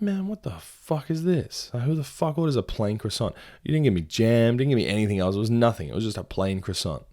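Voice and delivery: high, incredulous mock rant; retold outrage. Narrator retelling outrage at a plain croissant, high mock rant. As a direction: replay the disbelief at the plain croissant. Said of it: "And I was like".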